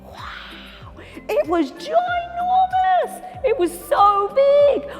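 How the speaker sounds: high, slow wonder; gaping at the huge hall; high awestruck big; awed. Acted. Awed inside castle.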